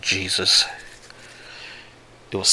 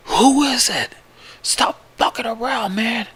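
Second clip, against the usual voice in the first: high-pitched, frantic yell; yelling outside; 5 dB louder than usual. Acted. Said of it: "We keep yelling outside".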